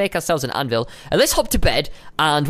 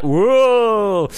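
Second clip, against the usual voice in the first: long drawn-out mock whoa; imitated whoa; 4 semitones higher, 4 dB louder than usual. Player imitating his companion, drawn-out playful whoa. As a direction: draw it out, higher, mimicking amazement. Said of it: "he's like".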